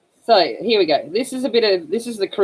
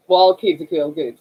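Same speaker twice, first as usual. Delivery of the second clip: ordinary talk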